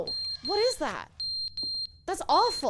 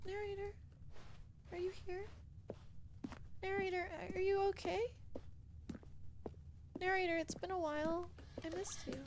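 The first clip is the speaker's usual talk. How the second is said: soft, hesitant calling; quiet call; 13 dB quieter than usual. Real, not acted. Player softly calling for the silent narrator.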